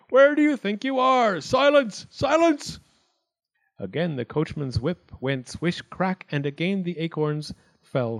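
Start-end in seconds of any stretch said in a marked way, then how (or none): 0.1-2.8 high, loud scolding; yelling. A narrator's reading. Wildcat quells the acorns once more, yelling high. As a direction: yell for order, climbing on each command. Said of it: "Wildcat yelled".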